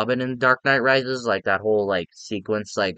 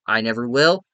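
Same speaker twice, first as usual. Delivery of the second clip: usual speaking voice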